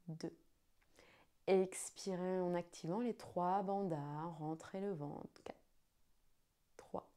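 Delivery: slow, hushed guided breathing count. Acted.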